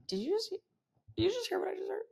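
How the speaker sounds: high, soft stage aside to a client; hushed disbelief. Retold or imitated. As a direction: retell a scandalised, near-whispered question. Asks client quietly, in disbelief.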